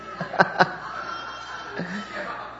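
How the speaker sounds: unvoiced laugh; breathy laugh. Real, not acted. Laughing at own joke.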